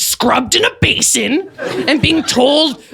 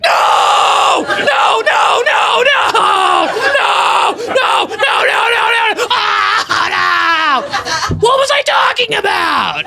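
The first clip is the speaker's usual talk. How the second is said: mock horrified screaming; 12 semitones higher, much slower than usual. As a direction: wail no over and over in mock horror. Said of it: "I'm just like".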